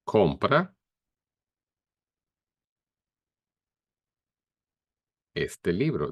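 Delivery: teacher models compra este libro for students; Spanish demo. Shown as a demonstration. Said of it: "So you can say in Spanish".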